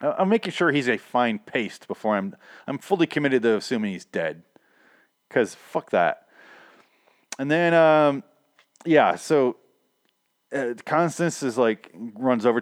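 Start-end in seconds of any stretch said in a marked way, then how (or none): none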